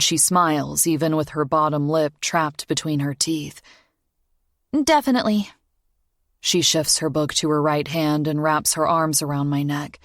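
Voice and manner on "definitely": put-on young girl voice. Child voice in story.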